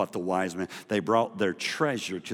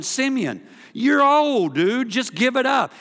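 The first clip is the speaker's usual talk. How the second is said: mocking crowd; 14 semitones higher, 9 dB louder than usual. Crowd mocking old Simeon.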